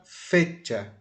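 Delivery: "fecha" with force on "fe"; slowly, weight on the "fe" of "fecha"; stressed first syllable. Shown as a demonstration. Teacher presenting an example word, "fecha" stressed on "fe". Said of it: "botar força mais no fe".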